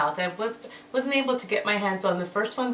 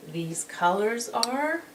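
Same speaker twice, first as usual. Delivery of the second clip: normal talking voice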